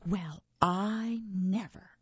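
slow, huffy monster; drawn-out huffy indignation; offended sniff. A narrator's reading. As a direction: say it with an affronted sniff. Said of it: "she sniffed".

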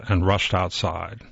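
ordinary delivery

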